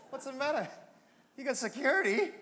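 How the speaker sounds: ordinary talk, nothing marked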